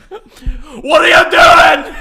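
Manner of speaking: loud, slow mock Scottish yell; Scottish accent. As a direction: scream it in a hammy Scottish accent. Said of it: "they would scream it".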